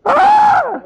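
sudden high mock scream of terror; acting out the disciples' terrified cry; ghostly scream. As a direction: shriek like you've seen a ghost.

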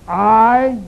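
a lone, loud I; stress on I. Shown as a demonstration. Preacher repeating the word he stressed, loud I. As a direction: hit the word I hard and alone. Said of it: "Did you hear what word I emphasized?".

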